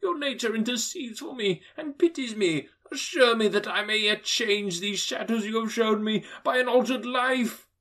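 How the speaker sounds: put-on high, desperate old miser; frightened old man begging the ghost; pleading Scrooge. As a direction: plead for a second chance.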